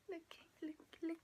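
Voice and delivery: hushed, breathy whisper; very soft, urgent whisper; whispered awe. Real, not acted. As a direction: whisper it as if showing a treasure. Hushed showing off the cover.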